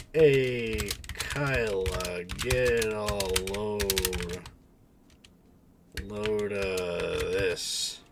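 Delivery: put-on slow, drawn-out New York accent. Streamer sounding out the phrase to Kyla, slow New York accent. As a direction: stretch each word in a loud New York twang. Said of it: "New York accent".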